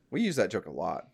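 ordinary delivery

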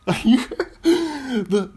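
very high, loud, drawn-out query; over-the-top falsetto questioning; hammed-up question. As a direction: push the question absurdly high and slow. Hamming up the phrase even further.

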